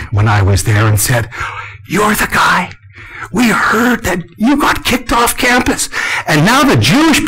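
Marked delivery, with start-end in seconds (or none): 1.9-5.9 lightly voiced students, high and excited. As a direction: students calling out to him, higher.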